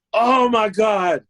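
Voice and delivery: high, frantic shout of sorry, slow and stretched; panicked drunk apology. Retold or imitated. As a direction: squeal it like a drunk who just wrecked everything. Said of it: "In my drunk, sober voice".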